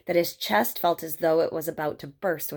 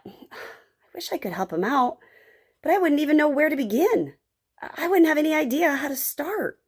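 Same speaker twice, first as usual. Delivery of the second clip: put-on high, helpless young Joey; wishing he knew how to help, pitched up; earnest high-voiced boy thinking aloud; boy's wistful thought; 8 semitones higher than usual. Boy's worried thought in story.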